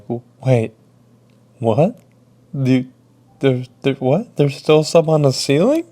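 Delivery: incredulous, rising to a high, loud question; stammering disbelief. Retold or imitated. Worker hears of mess left on ceiling, stammering disbelief. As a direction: stumble over the words, then echo it in shock. Stunned by coworker's news, echoes it.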